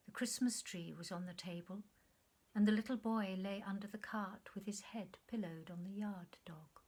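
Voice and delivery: usual speaking voice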